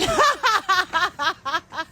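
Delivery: high laughing burst; long, high cackling laugh. Real, not acted. Laughing after telling of a threat to someone's bald head, high laugh.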